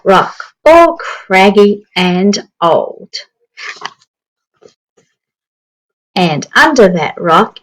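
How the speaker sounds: lively storybook narration for a class; reading to children. Acted. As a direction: read it like story time on the carpet. Reading picture book aloud.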